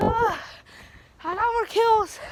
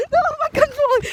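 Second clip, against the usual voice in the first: laughing; 9 semitones higher, 4 dB louder than usual. Real, not acted. Laughing as gun falls.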